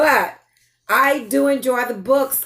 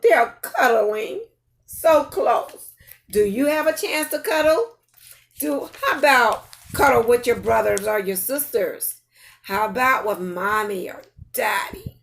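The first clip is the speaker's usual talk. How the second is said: usual speaking voice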